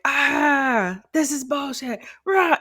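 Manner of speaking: a hammed-up frustrated groan rising into a growl; mock angry outburst. Telling her partner how she used to blow up, mock angry. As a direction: play your own old temper flaring up. Acting out her own anger.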